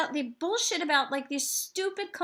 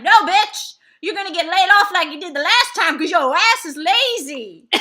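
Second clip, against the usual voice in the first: louder, higher blunt shout; blunt yell; 4 semitones higher, 11 dB louder than usual. Retold or imitated. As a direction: yell the hard truth at the caller. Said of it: "I'd be like".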